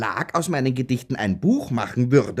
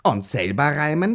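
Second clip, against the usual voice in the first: usual speaking voice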